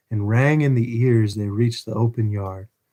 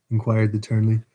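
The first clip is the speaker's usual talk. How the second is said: ordinary delivery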